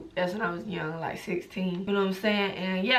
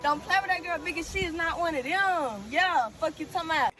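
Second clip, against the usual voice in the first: high, fast catchphrase voice; string slang together, pitched up; 8 semitones higher than usual. Acted. Girl in her video, pitched-up put-on slang voice.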